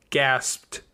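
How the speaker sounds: ordinary delivery, nothing marked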